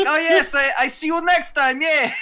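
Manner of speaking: put-on Russian accent. Imitating the Russian cashier at the convenience store in a mock Russian accent. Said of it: "he has a Russian accent and the broken English".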